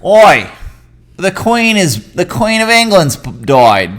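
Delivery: loud, high, hammed-up Aussie accent; Australian accent. Shown as a demonstration. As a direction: shout it in a broad fake Australian accent. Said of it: "let me do it in Australian".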